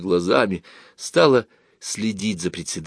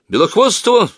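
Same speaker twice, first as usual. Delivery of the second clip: sharp, high, loud cry of a surname; вскрик; 13 semitones higher, 6 dB louder than usual. A narrator's reading. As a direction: cry out in alarm, much higher. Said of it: "вскрикнул Женя". (громкий высокий вскрик)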